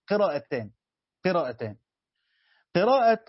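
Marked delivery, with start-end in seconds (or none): none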